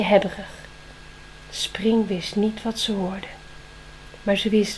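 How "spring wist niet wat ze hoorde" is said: plain reading voice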